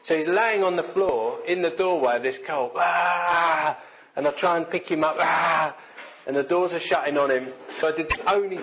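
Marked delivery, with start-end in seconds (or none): 2.8-3.8 a mock toddler's angry cry; imitated toddler wail. 5.2-5.8 a mock toddler's angry yell; imitated toddler shout.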